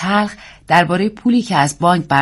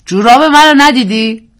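man asking; 6 semitones higher, 9 dB louder than usual. A narrator's reading. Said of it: "مردی پرسید".

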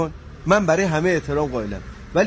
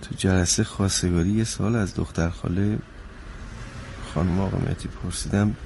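very low, subdued and halting; low, reluctant account of a blunder; sheepish confession; 13 semitones lower, 7 dB quieter than usual. Acted. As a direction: confess it softly, with pauses. Confessing the proposal mishap.

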